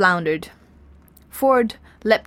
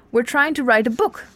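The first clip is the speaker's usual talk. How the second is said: mild yell, raised pitch; yelled protest; 4 semitones higher than usual. A narrator's reading. Ford protesting to the Vogon guard, mildly yelled. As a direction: plead the case indignantly. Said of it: "yelled Ford".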